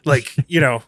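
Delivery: ordinary delivery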